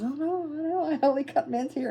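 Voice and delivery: lightly voiced haircutter objecting; protesting haircutter.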